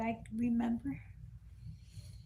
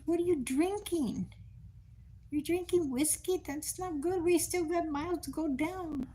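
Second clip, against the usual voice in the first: higher, half-scolding surprise; worried niece questioning her aunt; retold alarmed questioning; 8 semitones higher than usual. Scolding aunt drinking on berry walk.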